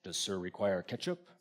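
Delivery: lightly voiced waiter, low and hushed; a hint of a formal waiter, softly spoken; discreet waiter. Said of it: "until he says".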